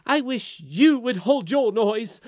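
high, loud, drawn-out scolding; rude. A narrator's reading. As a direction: scold the singer rudely, stretching the words. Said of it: "said the nurse rudely".

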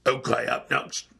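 put-on low croak, botched British accent; badly faked accent, sounding like a sick coach. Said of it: "sick Coach O".

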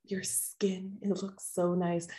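hungry villain eyeing her dinner, softly; creepy admiring. Retold or imitated. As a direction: admire him like prey. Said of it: "she goes".

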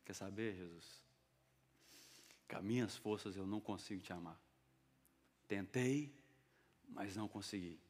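very soft, low admission of failure; acted defeated, hushed honesty; quiet confession. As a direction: confess it low, almost under your breath. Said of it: "Aí Pedro confessa".

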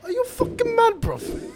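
squeaky, loud London gangster outrage; high-voiced Top Boy accent. Friend imitating Top Boy guys, shrieky high London accent. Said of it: "The fucking Top Boy guys when their voices get high".

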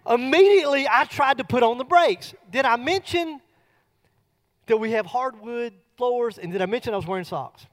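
ordinary delivery

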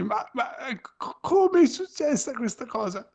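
high, baffled disbelief; bewildered. Retold or imitated. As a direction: ask it stunned and lost.

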